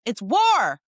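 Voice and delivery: real emphatic outburst; worked-up declaration. Real, not acted. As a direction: declare it loudly like a battle cry. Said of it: "I sound like a crazy person".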